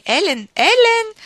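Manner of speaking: put-on high, loud, slow call of an old woman; piercing old woman's shout. A narrator's reading. Old grandmother calling Ellen from the veranda, high piercing slow shout. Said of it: "urlò con la sua vecchia voce penetrante".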